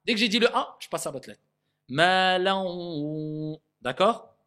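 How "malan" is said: "malan" with the nun merged, slow; pronunciation of malan. Shown as a demonstration. Teacher shows how the nun in "malan" disappears. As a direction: say "malan" slowly, the nun swallowed. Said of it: "je dis quand même le premier son".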